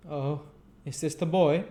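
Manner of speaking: put-on high sleepy old gentleman; old magistrate. A narrator's reading. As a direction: wake up and peer at him.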